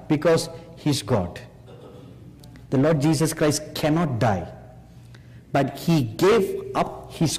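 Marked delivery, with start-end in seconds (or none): none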